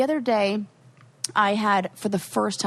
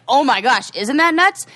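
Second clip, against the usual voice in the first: mock amazed; 5 semitones higher, 7 dB louder than usual. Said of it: "Ren was like".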